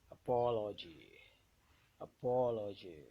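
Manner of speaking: teacher's demo of a-POL-ogy; stressed syllable. Shown as a demonstration. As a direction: hit POL hard in Apology, falling after it. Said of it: "the one that will be pronounced louder".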